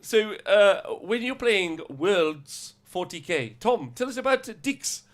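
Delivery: put-on high, loud South African accent; host in a South African accent. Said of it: "in our South African accents".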